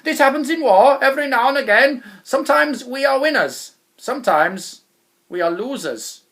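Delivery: loud blustering general's call; general shouting. A narrator's reading. General telling his men to retreat, shouting. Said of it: "shouted out to his men".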